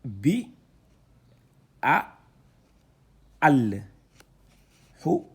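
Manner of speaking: loud, drawn-out syllables read one by one; syllable reading drill. Shown as a demonstration. Teacher spelling out syllables loudly for students. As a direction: sound out each letter for the class.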